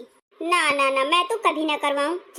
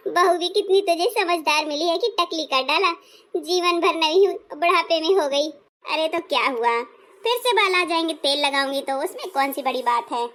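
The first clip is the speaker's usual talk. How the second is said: normal talking voice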